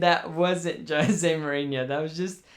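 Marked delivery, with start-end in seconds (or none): none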